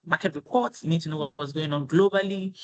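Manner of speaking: ordinary talk, nothing marked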